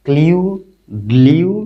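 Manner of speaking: ক্লিউ and গ্লিউ high, loud and rising; pronunciation drill. Shown as a demonstration. Teacher recites the earlier blends, pronunciation drill. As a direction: list ক্লিউ, গ্লিউ as separate blended syllables, each lifted. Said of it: "আমরা উপরে পড়েছি ব্লিউ".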